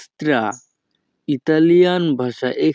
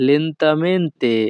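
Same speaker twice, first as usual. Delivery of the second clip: lentamente stretched out very slowly, syllable by syllable; 3 semitones higher, much slower than usual. Shown as a demonstration. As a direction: drag out lentamente to act out its meaning. Teaching the word for slowly.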